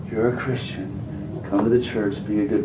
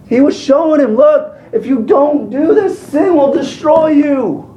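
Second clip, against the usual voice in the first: preaching at full force; 10 semitones higher than usual. Acted. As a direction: plead with the congregation, rising higher.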